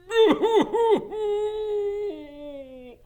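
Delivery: very high drawn-out wail; long sob. Acted. Hagrid wails as Norbert leaves, very high sob. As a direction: let the sob run. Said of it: "Hagrid sobbed".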